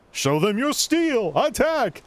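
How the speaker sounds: rally the troops like a holy knight; righteous paladin declaration. Acted. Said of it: "I love the whole paladin voice fight type thing".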